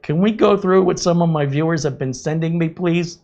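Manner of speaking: lightly imitating a Boston YouTuber's Boston accent. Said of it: "he's got that Boston, Boston accent".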